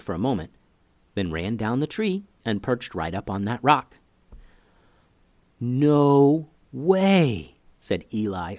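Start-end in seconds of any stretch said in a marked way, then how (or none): 5.6-7.5 drawn-out, slightly higher disbelief; amazed. A narrator's reading. Boy watching a squirrel obey his sister, amazed. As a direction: stretch both words out in amazement.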